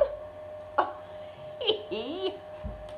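long, high-pitched squeal of delight. Real, not acted. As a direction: squeal with glee at a cute find. Squeals over a little elf.

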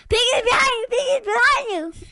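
loud high warning; urgent warning shout. Real, not acted. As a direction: call out the danger. Warning teammate.

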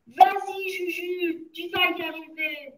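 high, held-back cheer; cried encouragement. A narrator's reading. Someone in the assistance cheering Juju, high cry. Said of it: "quelqu'un cria".

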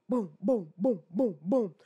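rhythmic "boom" sound effect; mock pounding footsteps; imitated running thuds. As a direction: punch out even, short booms. Imitates her running up to him.

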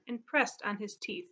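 plain reading voice

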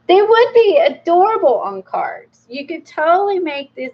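high, delighted gush; excited. Real, not acted. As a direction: squeal a little over something cute. Delighted by cute drawings.

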